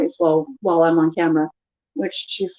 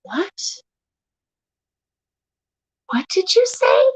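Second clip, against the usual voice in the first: high-pitched, incredulous question; mock indignation; 11 semitones higher, slower than usual. Wife challenging husband's grumpy old lady remark, indignant. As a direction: play up the offended disbelief.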